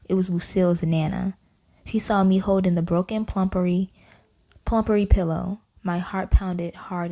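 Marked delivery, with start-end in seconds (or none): none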